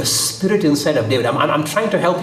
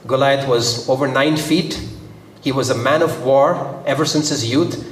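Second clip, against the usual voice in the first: ordinary talk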